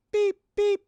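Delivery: high, loud robotic beeps; imitated beeping. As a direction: mimic an alarm beeping.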